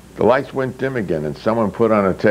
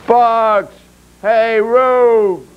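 much higher, louder rallying yell; shouting; 11 semitones higher, 5 dB louder, much slower than usual. Acted. Ben calling his comrades during the brawl, shouting. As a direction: yell for help at full voice. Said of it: "Ben shouted".

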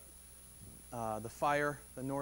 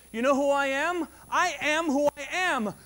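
God declaring; 7 semitones higher, 8 dB louder than usual. Acted. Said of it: "He says".

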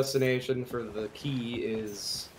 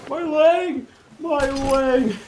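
squeaky, higher and louder mock cry; high mock pirate wail; 13 semitones higher, 8 dB louder, much slower than usual. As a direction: yell it like a silly pirate in pain.

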